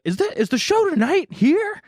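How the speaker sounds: high voice shouting a question upstairs; high called question. Retold or imitated. Host asking girlfriend upstairs about the show, high call. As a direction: call it up the stairs, pitched high.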